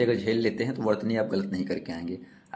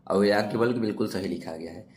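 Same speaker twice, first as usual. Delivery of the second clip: normal talking voice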